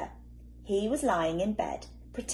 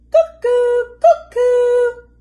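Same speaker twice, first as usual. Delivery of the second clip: high, loud cuckoo calls; bright cuckoo imitation; cuckoo clock call; 17 dB louder, much slower than usual. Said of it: "went the living room clock".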